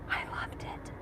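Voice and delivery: breathy hushed whisper. Real, not acted. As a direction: whisper it like a secret. Hushed confession after the show.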